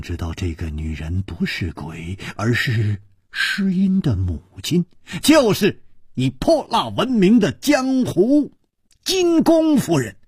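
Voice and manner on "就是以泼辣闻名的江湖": ordinary delivery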